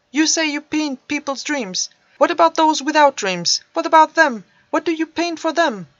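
loud, high, rising anger; angry teen. Acted. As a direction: hurl the questions at him in anger. Bitter teen confronts painter.

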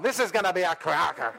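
hammed-up Irish accent; a playful stab at an Irish accent; bad Irish accent. Shown as a demonstration. Preacher jokingly repeats the line in a bad Irish accent. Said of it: "bad Irish accent".

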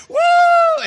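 huge, high whoop as he jumps; joyful whoop. Acted. Crazy kid leaping off the high dive, whooping wildly. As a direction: let out a wild whoop mid-leap.

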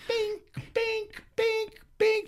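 high, far-off-sounding bink bink of rocks on a helmet; ping out the rocks bouncing off the helmet; imitated rock clinks. Rocks hitting his helmet.

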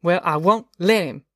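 put-on mildly fierce boy standing firm; lightly voiced stubborn child. A narrator's reading. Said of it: "Winfield said fiercely".

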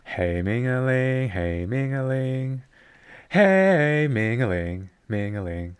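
slow, drawn-out catchphrase repeats; sing-song. Shown as a demonstration. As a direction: chime "Hey, Mingling" over and over. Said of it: "It's very sing-song".

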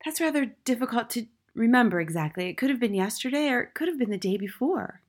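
put-on high little-boy voice; high-pitched, unruffled schoolboy; small boy, reasoning. A narrator's reading. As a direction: explain innocently in a high child's voice.

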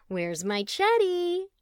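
high, slow cooing call; mother cooing for her boy; doting mother. Acted. Rita calling sweetly for her son Chetty, high and drawn out.

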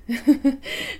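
bright high laugh breaking out; real burst of laughter. Real, not acted. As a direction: laugh at your own silly exercise. Laughing at her own demo.

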